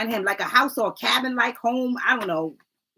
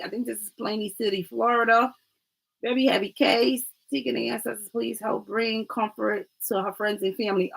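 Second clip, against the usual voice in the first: normal talking voice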